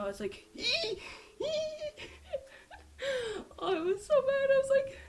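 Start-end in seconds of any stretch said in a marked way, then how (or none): 0.5-3.5 high, breathless laughing. Real, not acted. Arriving sweaty at the gate, high breathless laugh. 3.6-5.0 high, loud and laughing; laughing breathless. Retold or imitated. Telling gate staff about her sprint, laughing high.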